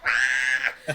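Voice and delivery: loud low mock scream; retold scream. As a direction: yelp it like a fright.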